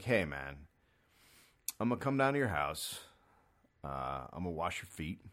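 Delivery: put-on low, soft creepy old man voice. Comedian voicing an old man offering to wash feet, low and soft. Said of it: "if I'm an old man and I'm like".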